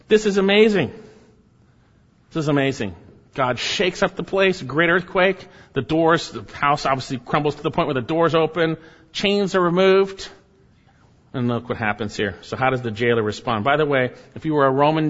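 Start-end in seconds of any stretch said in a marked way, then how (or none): 0.1-0.9 high, loud burst of amazement; amazed exclamation. Real, not acted. Preacher marvelling at the earthquake, amazed exclamation. Exclaims over miracle, then repeats it. 3.3-10.3 high, emphatic preacher's proclamation; excited preaching. Real, not acted. Preacher listing the miracle to the congregation, excited preaching.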